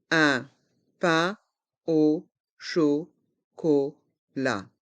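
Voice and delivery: slow "Un pain au chocolat", a gap after every syllable; learner-paced, split "Un pain au chocolat". Shown as a demonstration. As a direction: separate each sound of "Un pain au chocolat". Teacher breaks phrase into syllables.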